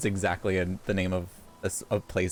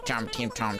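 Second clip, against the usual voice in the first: normal talking voice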